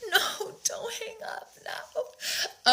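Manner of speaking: high, drawn-out breathy whimper; mock crying. Cheating boyfriend begging her to stay on the call, mock crying. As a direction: wail it softly like a mocked crybaby. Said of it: "he would start crying and be like".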